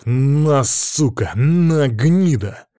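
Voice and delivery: furious outburst, punching the "На"; frenzied rage. A narrator's reading. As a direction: lash out in a frenzy with every word. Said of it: "теперь я вошёл в раж".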